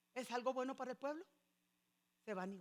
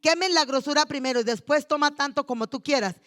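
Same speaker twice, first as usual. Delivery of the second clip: a loud preacher's quote of a demand; 3 semitones higher, 15 dB louder than usual. Retold or imitated. Eli's sons loudly demanding the fat. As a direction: bellow the order at the people.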